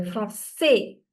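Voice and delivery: Français with weight on the final "çais"; stress on last syllable. Shown as a demonstration. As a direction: lean on the ending of Français. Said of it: "giving more emphasis to A".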